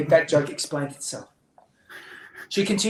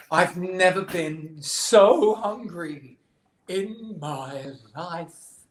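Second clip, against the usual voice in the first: melodramatic hunger; 3 dB louder, much slower than usual. Acted. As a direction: draw out the suffering, then fade away. Betty complains of great hunger.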